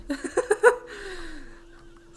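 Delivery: high, loud burst of laughter. Real, not acted. Player amused at the accent given, laughing. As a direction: laugh at your own silly choice.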